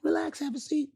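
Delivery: light high offer. Retold or imitated. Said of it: "so he's like".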